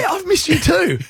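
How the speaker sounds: high, sweet, oblivious put-on greeting; innocent husband. Retelling greeting angry wife.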